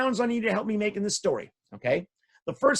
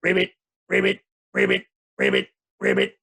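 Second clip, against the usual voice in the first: frog croak; 3 dB louder than usual. Acted. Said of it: "the frogs would go".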